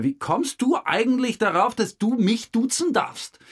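outraged demand, high and loud; indignant rebuke. Retold or imitated. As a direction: snap at the tramp for being so familiar.

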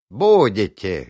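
Диновицер replies happily on the radio, cheerful. Acted. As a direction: answer with gusto. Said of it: "жизнерадостно ответил".

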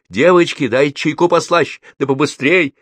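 high, loud, urgent call; shouted order. A narrator's reading. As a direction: shout it through to the kitchen girls. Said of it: "закричала". (громкий торопящий крик)

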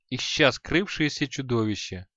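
plain reading voice

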